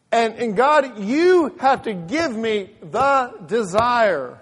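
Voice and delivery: loud demand to God, slowing heavily; slow down and press each word forcefully; emphatic preached plea. Retold or imitated. Preaches, retelling own prayer.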